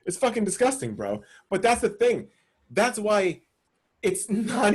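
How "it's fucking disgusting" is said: ordinary talk, nothing marked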